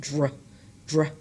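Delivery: "dr" as one short blended sound. Shown as a demonstration. Teacher showing students how D and R blend, short blended "dr". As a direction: run D and R together in "dr". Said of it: "that forms a blend".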